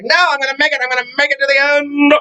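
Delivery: high, stubborn made-up voice; high mock stubborn vow, slowing at the end; mock mother impression. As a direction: dig in like a determined mom. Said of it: "It's not how she talks".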